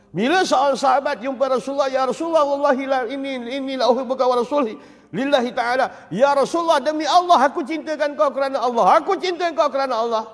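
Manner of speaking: high, loud preacher's voice; fervent preaching. Acted. Preacher to a mosque crowd, fervent preaching. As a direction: preach it out with force.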